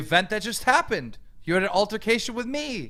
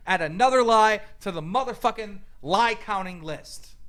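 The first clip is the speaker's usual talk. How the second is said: usual speaking voice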